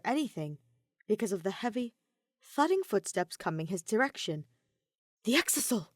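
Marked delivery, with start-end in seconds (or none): 5.2-5.9 sudden realization. A narrator's reading. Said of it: "Shuichi realized".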